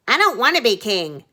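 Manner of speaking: put-on quick, protesting boy voice; reluctant prince. Prince refusing his father, quick protesting boy voice. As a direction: brush it off fast like a kid. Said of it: "said Ernest".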